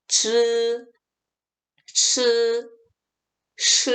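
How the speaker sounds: 즈, 츠, 시 held level without curling the tongue; wrong, uncurled retroflexes. Shown as a demonstration. Teacher shows students' mistake, uncurled 즈, 츠, 시. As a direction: leave the tongue flat on 즈, 츠, 시. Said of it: "많은 학우님들께서 이렇게 읽어요".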